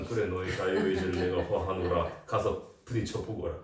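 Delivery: grand, archaic royal pronouncement, lightly hammed; a lofty king granting leave, a touch pompous; mock solemn decree. Preacher mocking a careless God who lets the child run at cars, pompous decree. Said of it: "자유의지니까".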